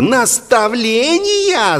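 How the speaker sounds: high, drawn-out yell of disbelief; outraged yell. A narrator's reading. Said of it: "заорал Игнат".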